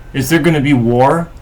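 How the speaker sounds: normal talking voice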